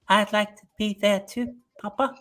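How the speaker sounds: a hint of a spooky British child; creepy British kid. Mocking a creepy kid with a British accent. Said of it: "a kid with a British accent".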